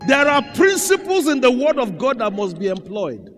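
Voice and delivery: fiery preacher at full force; preaching shout. Acted. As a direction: belt it out from the pulpit. Preacher rises to climax.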